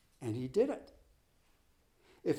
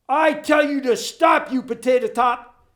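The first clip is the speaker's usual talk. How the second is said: gasped out yelling; 8 semitones higher, 10 dB louder than usual. Acted. Coach panting after his runner, yelling breathlessly. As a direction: bark it while out of wind. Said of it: "gasped Bost".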